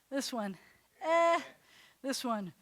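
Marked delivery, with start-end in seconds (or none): 1.0-1.4 a short, loud, raised "Eh"; eh vowel. Shown as a demonstration. Teacher running the vowel quiz, loud clipped "Eh". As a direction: punch out "Eh" for the drill. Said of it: "quiz, go".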